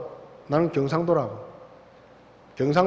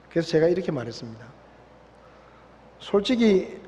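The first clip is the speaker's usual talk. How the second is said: ordinary talk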